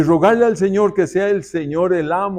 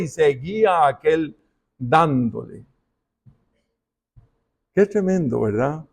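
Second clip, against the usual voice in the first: usual speaking voice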